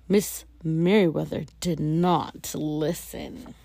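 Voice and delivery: ordinary delivery, nothing marked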